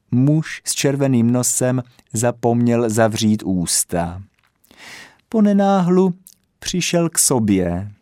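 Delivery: plain reading voice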